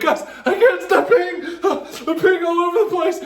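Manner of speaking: high, fast, giggling plea to friends; high laughing alarm. Retold or imitated. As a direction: beg the guys while laughing. Tripping, telling friends he's peeing.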